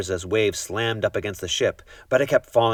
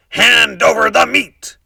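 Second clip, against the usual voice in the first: pirate demand; 14 semitones higher, 10 dB louder, slower than usual. A narrator's reading. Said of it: "Braidbeard gulped his food and said".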